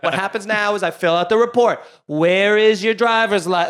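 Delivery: mock brusque DMV clerk, not having any of it; bossy, aggressive imitation; sassy cop.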